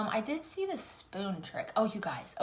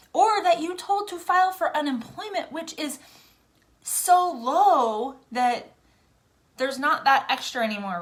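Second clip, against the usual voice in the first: higher, louder exasperated outburst; indignant rant; 7 semitones higher, 7 dB louder than usual. Real, not acted. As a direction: let the outrage spill over. Angry rant at husband's employer.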